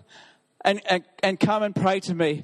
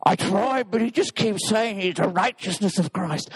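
frustrated demon. Acted. Demon reports failure to devil.

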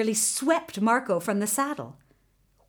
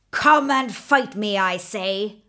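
louder dragon voice demanding a fight; dragon roaring; 5 dB louder than usual. A narrator's reading. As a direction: bellow the challenge at the little man. Dragon challenges Marco third time.

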